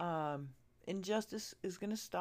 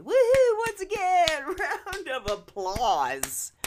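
cheering; 10 semitones higher, 10 dB louder, slower than usual. Real, not acted. Cheering people who told protesters off.

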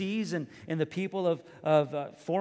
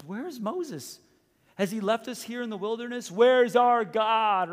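mild panicked crowd; 6 semitones higher, 7 dB louder than usual. Retold or imitated. People in the wilderness asking for Moses, rising anxious cry. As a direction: let the fear swell on where's our God. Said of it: "the people asked themselves".

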